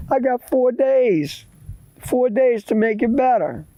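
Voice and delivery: put-on high-pitched pleading boy; acted boyish worry; worried boy. Scared boy answers grandma.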